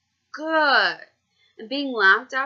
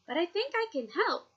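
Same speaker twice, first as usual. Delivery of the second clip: put-on bright old woman; 10 semitones higher than usual. A narrator's reading. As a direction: offer help cheerfully. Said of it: "she said brightly".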